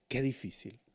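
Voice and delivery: a quiet, heavy Qué difícil; soft lament. Acted. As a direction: murmur Qué difícil to yourself. Repeated lament, soft then loud.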